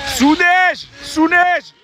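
excited shout. Real, not acted. Excited shout about snow.

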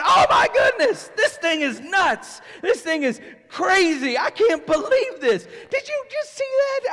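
high, loud astonished exclaiming; amazed crowd. Retold or imitated. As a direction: exclaim in disbelief at the miracle.

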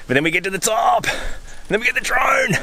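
high, sing-out cheer, stretched line endings; excited cheer. Real, not acted. Climber looking forward to drone shots at the top, excited cheer. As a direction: pitch climbing into a high, gleeful call.

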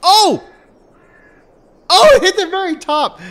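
high, loud surprised cry; excited. Real, not acted. Catapult hit reaction.

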